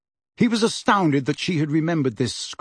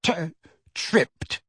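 halting, higher stammer; shakily; 3 semitones higher than usual. A narrator's reading. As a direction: stammer it out in a shaky little voice. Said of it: "said Dudley shakily".

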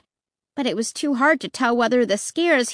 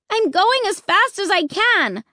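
screaming; 9 semitones higher, 3 dB louder than usual. Acted. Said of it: "I screamed".

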